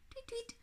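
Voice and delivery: tiny, very high chirps; birdsong. Acted. Morning birds start to sing, soft high tweeting. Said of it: "the birds started to sing".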